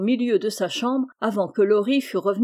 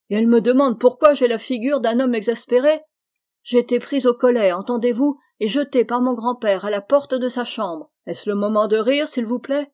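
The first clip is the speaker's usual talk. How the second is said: sudden rage; 3 semitones higher, 7 dB louder than usual. A narrator's reading. Laurie furiously blames Jo for his grandfather's insult, raging. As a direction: let the rage boil over, faster and louder. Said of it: "dans un subit accès de rage".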